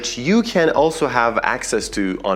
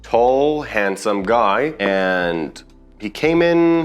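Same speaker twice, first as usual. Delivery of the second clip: ordinary talk, nothing marked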